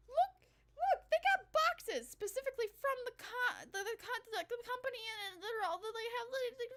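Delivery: overflowing with delight at the boxes; gushing. Real, not acted. Gushing over moving company boxes.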